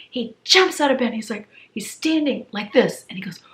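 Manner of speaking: ordinary delivery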